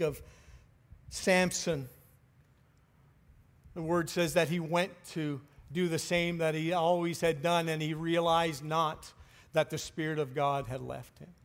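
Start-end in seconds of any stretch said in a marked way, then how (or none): none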